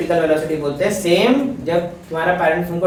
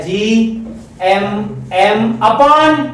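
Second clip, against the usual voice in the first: slow dictation; 3 semitones higher, 3 dB louder, much slower than usual. Shown as a demonstration. Teacher dictating formula slowly.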